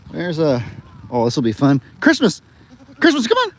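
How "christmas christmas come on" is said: loud high call across the field. Real, not acted. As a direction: shout the name to bring her in. Calling animals from afar.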